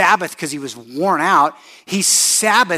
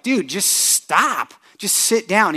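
slow, higher, pleading "Dude, just stop"; lightly voiced exasperated wife; 5 semitones higher, slower than usual. Wife telling restless husband to sit down, exasperated. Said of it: "my wife is like".

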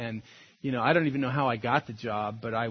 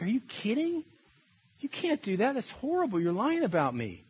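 higher mock outrage at the liar; imitating angry protest; 8 semitones higher than usual. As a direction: replay your hurt indignation, pitched up. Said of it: "I'm like".

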